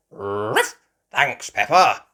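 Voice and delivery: loud, barking dog character; bark, then call out thanks loudly; loud cartoon dog voice; Grandad Dog. A narrator's reading. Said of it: "Grandad Dog and Danny Dog said".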